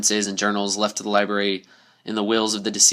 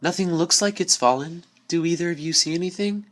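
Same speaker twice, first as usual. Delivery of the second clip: higher, uneasy question; 8 semitones higher than usual. A narrator's reading. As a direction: ask nervously into the dark. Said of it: "The unicorn spoke uneasily".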